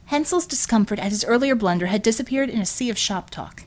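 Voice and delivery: ordinary delivery, nothing marked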